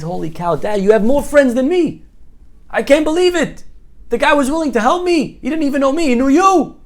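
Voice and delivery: high, loud disbelieving cry; astonished excitement. Acted. Son tells dad the man helped, astonished. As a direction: blurt it out amazed, pitch way up.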